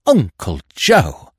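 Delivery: slow, intense and earnest. A narrator's reading. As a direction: lean in with fervour. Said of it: "said Freddy with equal intensity".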